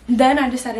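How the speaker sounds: normal talking voice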